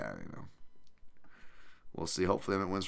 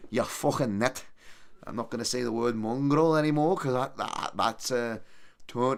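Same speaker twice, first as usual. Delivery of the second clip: higher-pitched attempt at a Scouse accent; Scouser accent; 5 semitones higher, 3 dB louder than usual. Shown as a demonstration. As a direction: put on a rough amateur Scouser accent. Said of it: "your Scouser accent".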